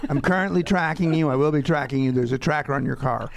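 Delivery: fast, pushy, upbeat creep; creepy boyfriend voice. Acted. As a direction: play a cheerful stalker. Playing the creepy tracker guy.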